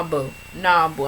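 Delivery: normal talking voice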